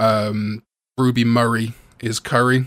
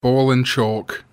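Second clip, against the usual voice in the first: slow, deliberate repeat; much slower than usual. Shown as a demonstration. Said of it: "what am I going for?".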